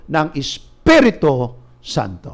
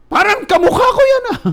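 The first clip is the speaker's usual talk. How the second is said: father's voice pitched way up and louder; sheepish father; 5 dB louder than usual. Retold or imitated. Embarrassed father denying he's the drunk on video, high squeaky voice. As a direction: squeak out a feeble denial. Father denies it's him.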